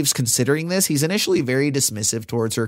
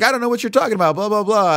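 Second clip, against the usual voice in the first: much higher mock-innocent denial; the guard dodging Treves' questions, higher and mock-innocent; guard denying it; 9 semitones higher, 4 dB louder, faster than usual. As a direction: play dumb, pitched way up. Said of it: "he's like".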